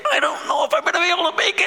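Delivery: high, fast, frantic voice; the world voiced in high-pitched panic; panicked world. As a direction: gasp it out like you're barely holding on. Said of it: "the world is like".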